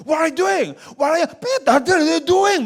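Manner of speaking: high-pitched mock outrage; outraged protest. Preacher acts an American just stabbed, outraged protest. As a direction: play-act a shocked, angry victim. Imagines reacting to being stabbed.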